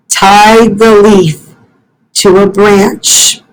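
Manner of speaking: usual speaking voice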